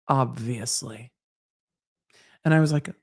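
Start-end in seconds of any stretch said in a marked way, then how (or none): 0.1-1.1 slow, low and softer, full of disdain; disgusted Snape drawl. Retold or imitated. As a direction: drip contempt onto every syllable. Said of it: "flipping through my passport with disgust".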